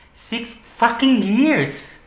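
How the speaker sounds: high, very slow and bitter; bitter outrage. Acted. Dumped partner counting the years, bitterly outraged. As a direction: drag out each word in hurt anger. Hurt by end of six-year relationship.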